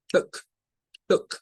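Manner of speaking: Book clipped very short, lax oo; two quick, separate bursts of Book. Shown as a demonstration. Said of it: "Es rápido".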